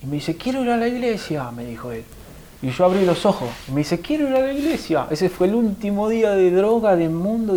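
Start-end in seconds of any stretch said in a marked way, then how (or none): 0.4-1.5 high-pitched, lilting quoted plea; retold inner voice. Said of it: "una voz que me resonaba". 4.0-5.1 markedly high quoted plea; retold inner voice. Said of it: "una voz que me resonaba".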